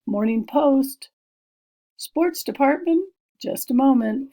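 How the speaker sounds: a lightly voiced operator picking up a call; switchboard operator. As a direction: answer the line like a busy switchboard operator. Reads operator's phone greeting.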